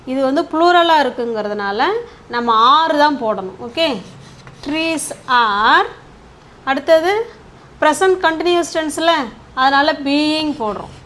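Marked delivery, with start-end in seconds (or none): 4.7-5.9 drawn-out words, ending on a rise; slow dictation. Shown as a demonstration. Teacher building a passive sentence for students, slow dictation. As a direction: stretch it out for students writing along.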